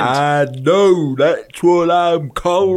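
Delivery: lightly whiny mock caller; a touch of mock exasperation. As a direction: whine it like an annoyed caller. Mocking the receptionist reply.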